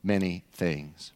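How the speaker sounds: low and very slow; weighted, word by word, with a pause; grave announcement. Acted. Jesus changing what Messiah means, grave and slow. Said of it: "here's where he changes it".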